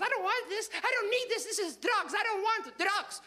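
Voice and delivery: put-on high, accented indignant refusal; high-pitched mock maternal anger; angry mother. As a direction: reject it as an outraged immigrant mom. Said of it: "she was so angry".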